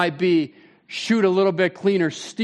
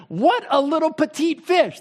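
higher mock scoff at a tiny fish; scoffing fisherman; 7 semitones higher than usual. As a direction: tease it like a proud musky fisherman. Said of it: "You're like".

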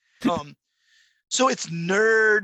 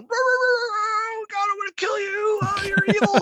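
much higher, loud, frenzied dog yelling through the door; frantic barking dog; 6 dB louder than usual. Retold or imitated. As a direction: bark and rage like a dog gone wild. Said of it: "Oki is in the house going".

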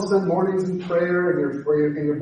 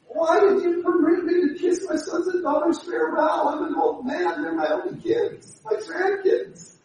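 pity; 11 semitones higher than usual. Retold or imitated. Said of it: "He uses pity".

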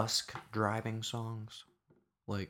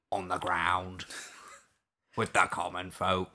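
down-to-earth British accent; commoner's British accent, drawn out; low-register British accent; 5 dB louder, slower than usual. Shown as a demonstration. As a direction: drop into a common-folk British voice. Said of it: "you can get down here".

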